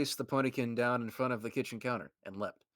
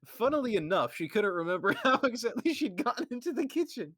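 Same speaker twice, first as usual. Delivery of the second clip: raised, lilting fairy-tale telling; higher storybook narration; 8 semitones higher than usual. A narrator's reading. Narrator telling the Rarity part of the run, storybook voice. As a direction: read it like a bedtime storybook, pitched up.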